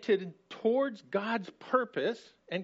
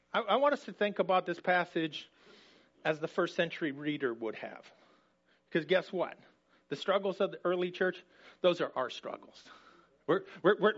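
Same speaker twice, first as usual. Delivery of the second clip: ordinary talk, nothing marked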